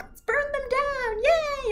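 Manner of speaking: put-on bubbly goth girl shouting a gleeful yay; chipper goth girl. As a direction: cheer for burning things like a happy goth. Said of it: "She's like".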